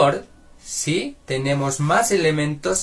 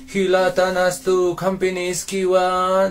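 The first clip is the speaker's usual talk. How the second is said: slow even monotone on one pitch, higher; monotone demo; 6 semitones higher, 4 dB louder, slower than usual. Shown as a demonstration. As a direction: drone it out with no melody. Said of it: "No hablamos de esta forma".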